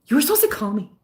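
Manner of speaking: slightly high put-on whine; Mean Girls impression. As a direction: lightly parody a wronged movie girl. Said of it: "that movie Mean Girls".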